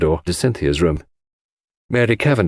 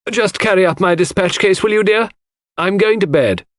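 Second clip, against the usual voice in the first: put-on higher, louder call of an elderly lady; old lady calling; 11 semitones higher, 3 dB louder than usual. A narrator's reading. Mrs Inglethorpe calls to Cynthia from the hall. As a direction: call up the stairs to the girl.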